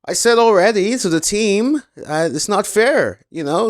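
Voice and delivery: imitating a Dutch driver's defiant radio voice; Max Verstappen impression. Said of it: "let me try my Max Verstappen impression".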